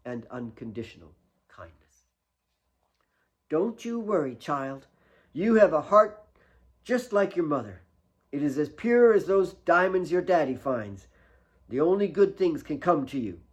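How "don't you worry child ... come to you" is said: warm, higher motherly voice; Mary comforting. Acted. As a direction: soothe the crying girl like a big loving nanny. Mary comforts crying Kate.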